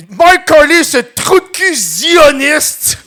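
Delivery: high, loud and slow mock rant. Imitating the woman insulting the prime minister in front of police, loud slow mock rant. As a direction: drag out the insult like an indignant woman. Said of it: "c'est comme".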